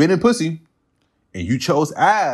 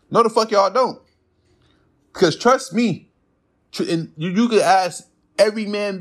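heated retort; 9 semitones higher than usual. Real, not acted.

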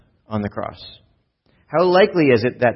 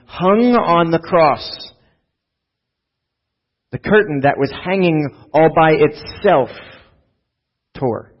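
slow dramatic build; 5 semitones higher, 4 dB louder, slower than usual. Real, not acted. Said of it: "dramatic slowing at climax".

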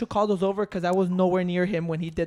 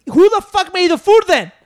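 loud, higher mock yell; retold yell; 8 semitones higher, 8 dB louder than usual. Retelling yelling at his roommate over the food. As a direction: blurt it out as a loud accusation. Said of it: "I'm like".

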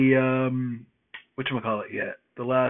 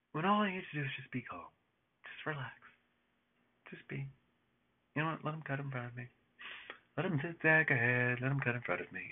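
much softer, gentle self-soothing; calming down; 12 dB quieter, faster than usual. Retold or imitated. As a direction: ease off and tell yourself to relax. Talking himself into calm.

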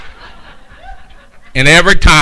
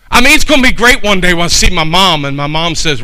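ordinary delivery

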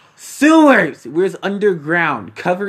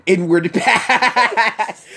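laughing; 3 semitones higher, much slower than usual. Retold or imitated. Reading his shirt joke.

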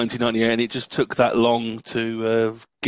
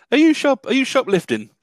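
mock shocked shopkeeper; 9 semitones higher, 4 dB louder than usual. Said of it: "she's going like".

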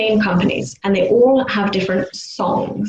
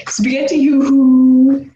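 louder, drawn-out street vendor call; newspaper seller shout; 4 semitones higher, 6 dB louder, slower than usual. Retold or imitated. Mimicking the newspaper seller passing the house, a loud slow hawker call. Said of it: "he shouts something".

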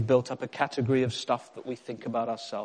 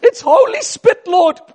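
very high, loud caricature prayer; hammed-up slow cry to the Lord; praying neighbour; 18 dB louder, slower than usual. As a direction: squeal a mock prayer, drawn out. Imagined neighbour praying over her cakes.

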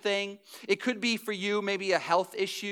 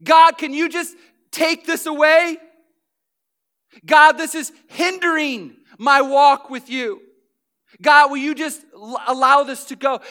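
pleading to God at a breaking point, loud and higher; desperate loud plea; 6 semitones higher, 11 dB louder than usual. Retold or imitated. Paul at his breaking point begs God, loud desperate plea. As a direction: cry out to God, voice raised and strained. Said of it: "got to a breaking point and said".

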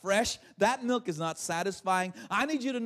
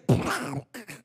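imitated impact noise; low, explosive mock crash; vocal sound effect; 9 semitones lower than usual. Shown as a demonstration. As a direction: make a burst of noise with the mouth. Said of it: "that's sound effects".

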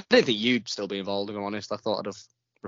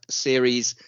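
slow, louder announcement; announcer; 7 dB louder, much slower than usual. Acted. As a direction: spell out the episode number slowly. Announcing the episode number.

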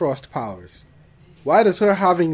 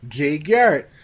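sarcastic mock-excited retelling; 3 semitones higher, 7 dB louder than usual. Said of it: "I'm like".

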